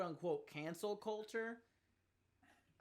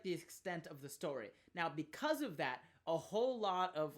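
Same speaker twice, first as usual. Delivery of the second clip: ordinary talk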